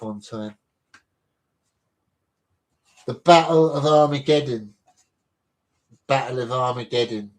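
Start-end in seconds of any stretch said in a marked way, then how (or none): 3.1-4.7 announcer title read. Acted. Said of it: "reads out the entry title".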